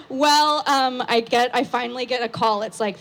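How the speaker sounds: normal talking voice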